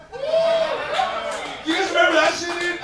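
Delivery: slow, high, laughing shout; excited shout. Real, not acted. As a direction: stretch it out hollering at the crowd. Hyping crowd about old wax.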